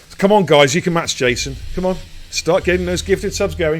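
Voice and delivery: loud, high-pitched urging; rallying hype. Real, not acted. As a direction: egg the crowd on. Rallying viewers to gift subs.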